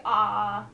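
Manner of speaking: open-mouthed drawn-out ahh; saying ahh. Shown as a demonstration. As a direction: sing out a clear ahh. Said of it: "Can you say".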